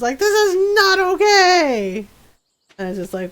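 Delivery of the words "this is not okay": high, slow, indignant wail; hammed-up upset outburst; mock outrage. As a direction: imitate a man protesting, stretching every word. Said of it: "he gets so freaking mad".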